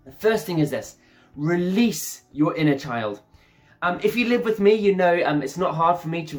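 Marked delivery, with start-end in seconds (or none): none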